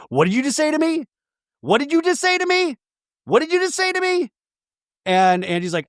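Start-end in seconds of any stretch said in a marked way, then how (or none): 0.1-4.3 rising higher with each repeat; hammed-up, climbing outrage; mock confrontation. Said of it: "Mary Cosby's like".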